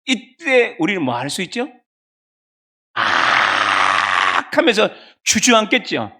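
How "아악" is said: long drawn-out, low acted groan of pain; acted dying cry, held long; stabbed cry of agony. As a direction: let out a long "aak" as if stabbed and collapsing. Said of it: "칼에 찔려 엎드려져 외치는 소리".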